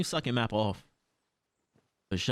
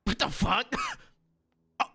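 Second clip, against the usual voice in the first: ordinary delivery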